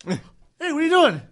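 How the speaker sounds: high alarmed waking cry; startled waking up. Retold or imitated. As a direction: jolt awake and challenge them. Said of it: "I've woken up several times like this".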